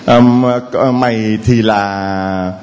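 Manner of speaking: God musing slowly over a name; slow pondering God. Acted. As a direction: stretch each word as if still deciding the name. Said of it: "bây giờ đang nghĩ".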